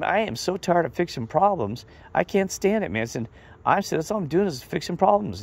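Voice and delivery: high, fed-up whine about fixing problems; mock exasperation, voice pitched well up; exasperated complaint. Retelling his frustrated complaint to Steve.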